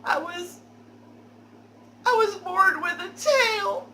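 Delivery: high, loud wail of fake tears; fake crying. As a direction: sob it out, hamming up the fake tears. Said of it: "fake tears that I can create".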